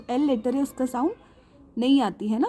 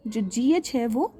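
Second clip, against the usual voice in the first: ordinary delivery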